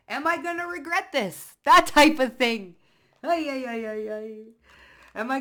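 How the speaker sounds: high, loud, fretful worry; anxious inner voice. Pretend. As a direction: wail the worry dramatically. Acting out anxious inner thought.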